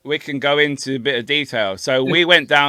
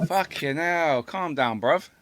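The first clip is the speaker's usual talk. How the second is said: much softer grumbling aside; quiet, exasperated telling-off; hushed exasperation; 8 dB quieter, slower than usual. Real, not acted. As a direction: mutter it under your breath. Muttered aside after the interruption.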